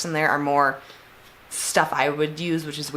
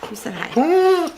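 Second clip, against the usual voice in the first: high, slow, delighted pet praise; excited praise; 10 semitones higher, 5 dB louder, slower than usual. Real, not acted. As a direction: cheer on the dog. Praising the dog.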